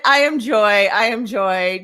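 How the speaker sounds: firm repeated affirmation; repeating back. Shown as a demonstration. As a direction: answer back to the negative voice. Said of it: "Just repeat back to it".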